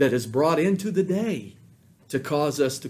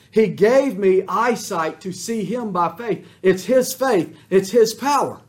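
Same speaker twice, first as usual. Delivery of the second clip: loud, high preaching voice; fiery sermon delivery; full-force preaching; 6 semitones higher, 10 dB louder than usual. Acted. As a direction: declare it with rising force.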